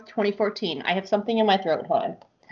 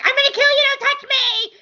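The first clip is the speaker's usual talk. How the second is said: high, louder snarling threat; mock furious threat; 5 dB louder than usual. Retelling the book, she threatens the helpful man, mock shriek. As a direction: spit it out like an angry cat. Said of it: "And she's like".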